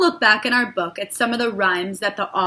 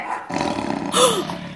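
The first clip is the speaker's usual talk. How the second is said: mock scared gasp; 10 semitones higher, 6 dB quieter than usual. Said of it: "that was scary".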